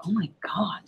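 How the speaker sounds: hushed whisper of disbelief; whispered oh my God. Retold or imitated. Overhearing the angry woman, a shocked whisper. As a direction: breathe it out in a whisper. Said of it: "I sat and I go".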